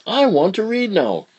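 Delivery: put-on little girl voice; squeaky young child; high child voice. Child character voice.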